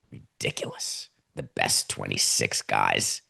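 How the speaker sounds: very low, hushed mutter of disbelief; disbelieving mutter. Real, not acted. Scornful muttering after mocking perfection.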